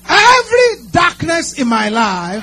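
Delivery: loud, high angry shout. Shown as a demonstration. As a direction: hurl it out with all your anger. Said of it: "with all the anger you can gather".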